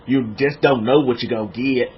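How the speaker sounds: put-on low, drawn-back country-boy movie voice; Forrest Gump. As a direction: drop the pitch for a folksy film quote. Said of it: "You remember when he said in that movie?".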